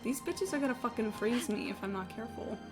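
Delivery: usual speaking voice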